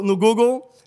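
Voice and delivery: ordinary delivery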